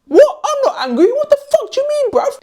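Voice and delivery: high, loud defensive snap; hammed-up offended reply, way up high; mock angry outburst. Shown as a demonstration. Person told they're angry, firing back in mock fury. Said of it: "If you say to people, "I think you're angry".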